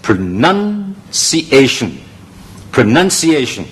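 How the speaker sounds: pronunciation慢慢逐個音節讀，次重音同主重音都讀出; 拉慢清楚示範pronunciation; 示範讀音. Shown as a demonstration. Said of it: "成個字嘅讀法". (教學式慢讀pronunciation，音節分明)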